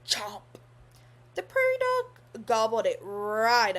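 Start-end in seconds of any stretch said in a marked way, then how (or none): none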